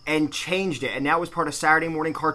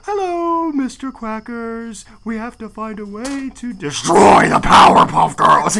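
high creepy voice, slow and drawn out; sweet-then-sinister villain speech; eerie villain voice; 4 semitones higher, 5 dB louder, slower than usual. Retold or imitated. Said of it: "most eerie fucking voice".

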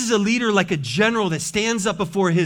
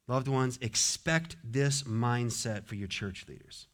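usual speaking voice

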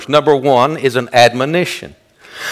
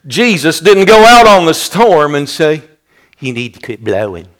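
higher, louder pulpit cadence; preacher building toward the climax, loud; fiery preaching; 5 semitones higher, 6 dB louder than usual. Acted. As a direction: drive the point home to the congregation. Preaching at full force.

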